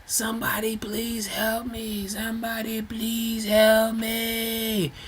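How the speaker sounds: high, soft, mock frantic plea for help; retold screaming woman. A woman begging passersby to help her hold up a man, in a mock high plea. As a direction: imitate her thin desperate cry, slow and breathy. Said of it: "she's screaming at the top of her lungs".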